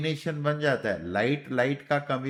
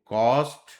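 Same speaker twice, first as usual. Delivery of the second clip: Cost said louder with a rise; emphatic word; 6 dB louder, slower than usual. Shown as a demonstration. Teacher stressing cost to students, louder. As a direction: punch cost louder to contrast the outlines. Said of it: "कि देखो".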